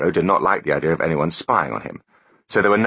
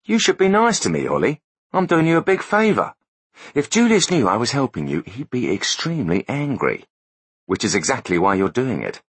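higher, smug know-it-all voice; smug techie voice; 7 semitones higher than usual. Acted. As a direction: play the gloating tech expert, pitched up. Foley voiced in story.

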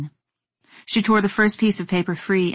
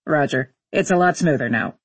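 low, understated pilot reply; astronaut on radio; 5 semitones lower than usual. Acted. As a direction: answer mission control matter-of-factly. Astronaut answers mission control by radio.

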